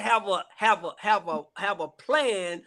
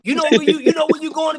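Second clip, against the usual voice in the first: mock pushy pitch; 7 semitones higher, 4 dB louder than usual. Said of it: "I don't jump out and say".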